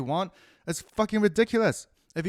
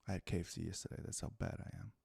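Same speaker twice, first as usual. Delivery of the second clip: whispered confession of bad eating; 9 semitones lower, 16 dB quieter, faster than usual. Real, not acted. Confessing to viewers about KFC in a whisper. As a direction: breathe it out like a guilty secret. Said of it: "confessing KFC habit".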